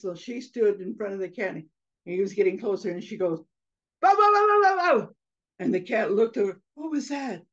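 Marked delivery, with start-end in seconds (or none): 4.0-5.1 loud, high rapid-fire barks; dog barking. Acted. Mother mouse scaring off the approaching cat, barking. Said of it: "Barking Mouse". 6.7-7.4 puzzled cat. Acted. Cat puzzled by barking mouse.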